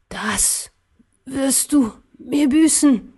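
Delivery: a hint of a hateful dying ruler, slow; dying emperor's threat. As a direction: drag out the threat with last strength. Dying emperor, hate-filled threat.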